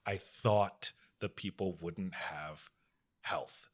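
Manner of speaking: low, slow, hushed relief; retold tearful relief. Doctor on his knees after learning of the trick, tearful relief. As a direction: quietly imitate someone overcome, with heavy pauses. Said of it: "starts crying".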